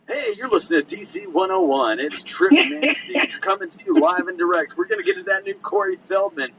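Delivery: loud, high hyped-up radio DJ. Pretend. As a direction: boom it out like a hyped FM jock. Parody radio DJ, Trip Nasty.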